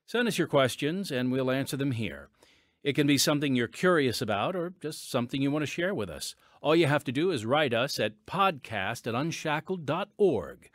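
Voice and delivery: ordinary delivery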